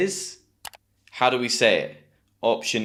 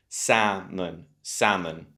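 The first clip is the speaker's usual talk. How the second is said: Salmon as SAM-un, weight on SAM; salmon with a strong first beat and weak ending; first-syllable stress; 3 semitones lower, slower than usual. Shown as a demonstration. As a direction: stress the start of Salmon, L silent. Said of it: "You got stress on the first syllable".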